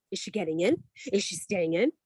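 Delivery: softly voiced wondering kids; amazed kids, hushed. Mother imitating her kids watching her, hushed amazement. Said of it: "my kids were like".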